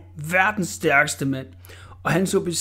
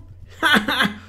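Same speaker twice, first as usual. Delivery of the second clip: loud, higher mocking bully laugh; roaring laugh; 8 semitones higher, 4 dB louder than usual. A narrator's reading. Orla laughs off the warning, loud. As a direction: laugh it out big. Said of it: "brølede Orla".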